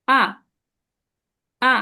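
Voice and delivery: আ dropping sharply; falling tone. Shown as a demonstration. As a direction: cut আ down from high. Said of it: "falling tone". (আ উঁচু থেকে নেমে যাবে)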